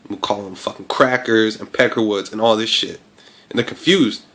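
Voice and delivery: ordinary delivery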